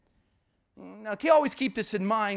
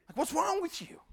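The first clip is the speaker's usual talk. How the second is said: exasperated; 8 semitones higher, 5 dB quieter than usual. Retold or imitated. Preacher voicing exasperated God.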